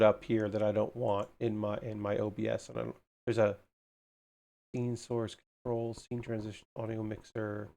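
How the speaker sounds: low, soft muttering under the breath; muttering to herself. Real, not acted. Streamer fixing her OBS, muttering quietly to herself. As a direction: mumble it to yourself, half forgetting the audience.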